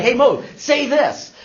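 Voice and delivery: high, drawn-out goading; mocking coworkers. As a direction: egg on the foreigner for a laugh. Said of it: "they couldn't stop laughing".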